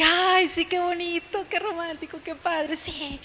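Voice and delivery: gushing women. Retold or imitated. Women reacting to the pastor's romantic birthday plan, gushing. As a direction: coo it dreamily, imitating thrilled women. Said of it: "nosotras mujeres de que".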